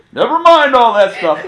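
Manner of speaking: high, loud laughing dismissal. Real, not acted. Pastor laughing as the scare passes. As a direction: shrug it all off with a laugh.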